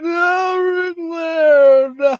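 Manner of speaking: high, loud wailing sob; retelling a child's crying fit at WrestleMania, high and loud; hysterical crying. Retold or imitated. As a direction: wail it like a heartbroken kid. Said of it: "I was just crying hysterically".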